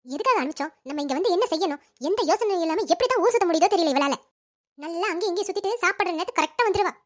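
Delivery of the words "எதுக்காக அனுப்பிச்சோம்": ordinary talk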